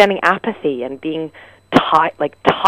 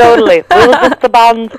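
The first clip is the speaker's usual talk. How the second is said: hammed-up Liverpool accent, louder and higher on "We were just a band."; [name] Liverpool accent; 8 semitones higher, 8 dB louder than usual. Echoing the [name] joke for laughs in a full Liverpool accent. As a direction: play the [name], broad Liverpool twang. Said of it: "Oh, the [name]!".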